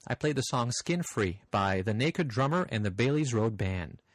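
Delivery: ordinary talk, nothing marked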